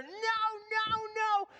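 very high, whiny repeated no; child refusing. Retold or imitated. Son sulking at dad's rule, high whiny refusal.

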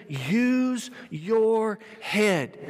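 very slow, each word separate; slow, spaced-out slogan; deliberate key phrase. Real, not acted. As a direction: stretch every word to drive it home. Drives home the key phrase.

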